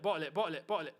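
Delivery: usual speaking voice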